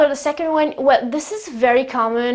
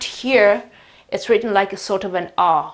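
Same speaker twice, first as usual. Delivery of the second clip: ordinary delivery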